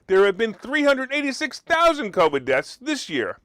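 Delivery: ordinary delivery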